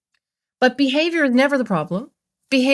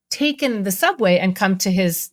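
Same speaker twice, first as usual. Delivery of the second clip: usual speaking voice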